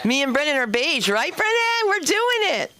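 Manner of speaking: high-pitched, rising glee; excited cheering. Real, not acted. Comic calls to Brennan about being beige, excited cheer. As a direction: call out excitedly to your buddy.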